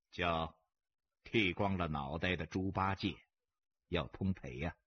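low, even-tempered insult; unruffled mockery; calm taunt. A narrator's reading. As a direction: deliver the insult coolly, deep in pitch. Stranger insults gambler calmly.

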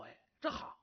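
hushed, whispered aside of quiet glee; secret whisper. Acted. A ruler whispering his private delight. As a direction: whisper it as if to yourself.

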